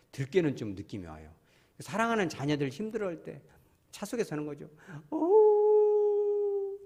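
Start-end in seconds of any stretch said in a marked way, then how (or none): 5.1-6.8 high loud wailing cry; jackal howl. Shown as a demonstration. Preacher imitating a grieving jackal, high wail. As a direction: howl in grief. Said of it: "들깨가 볼 때".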